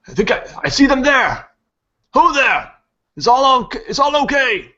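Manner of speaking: very high, loud hail; excited townsperson calling out. Acted. Villager pointing and hailing the party, excited high call. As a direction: shout across to them in a high-pitched voice.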